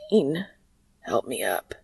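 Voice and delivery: low, hushed plea for help; desperate plea. A narrator's reading. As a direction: plead weakly, almost in a whisper. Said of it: "said with a desperate frown".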